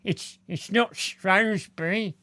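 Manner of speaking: mimicking a fussy corrector, drawn out; mocking imitation. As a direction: put on a silly pedant's voice. Mimics those who correct him.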